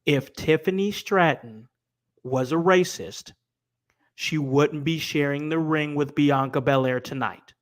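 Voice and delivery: slow, measured statement with pauses between phrases; spaced-out, deliberate delivery; deliberately slow. Real, not acted. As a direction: say it slowly so it sinks in. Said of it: "I'm gonna say it slow".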